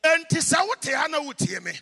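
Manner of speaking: loud, high fervent sermon delivery; preaching at full force. Acted. Preacher blessing his congregation, preaching at full force. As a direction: proclaim the blessing over the whole church.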